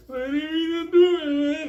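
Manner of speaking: high sung wail; mock crier. Crying inmate wails his innocence, high and sing-song. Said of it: "Then you got the criers".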